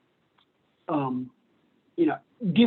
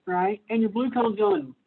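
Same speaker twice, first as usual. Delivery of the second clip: usual speaking voice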